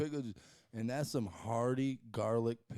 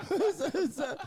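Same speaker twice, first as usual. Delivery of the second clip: laughing; 13 semitones higher, 6 dB louder than usual. Real, not acted. Laughing at the Wickles mayo idea.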